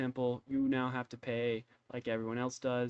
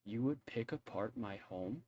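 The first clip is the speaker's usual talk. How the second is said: menacing; 8 dB quieter than usual. Acted. NPC threatens at crossbow point.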